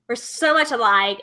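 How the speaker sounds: ordinary delivery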